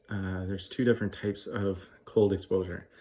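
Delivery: ordinary talk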